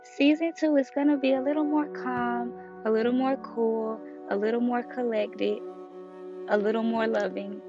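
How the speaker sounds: ordinary delivery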